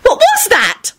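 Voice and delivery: very high, panicked cry; terrified shout. A narrator's reading. Elizabeth shouting after the explosion, terrified. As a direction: cry out in terror, pitch shooting up. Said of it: "shouted in a terrified voice".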